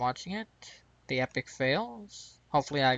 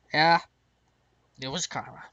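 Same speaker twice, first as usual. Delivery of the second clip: ordinary delivery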